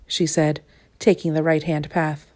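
usual speaking voice

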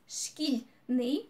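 "шкільний" as two beats, шкіль and ний apart; syllable by syllable. Shown as a demonstration. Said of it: "also two syllables".

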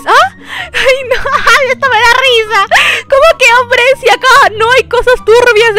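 high, laughing incredulous ah; giggling disbelief. Real, not acted. Girl laughing at a stranger's strange offer, incredulous. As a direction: crack up at the absurd claim.

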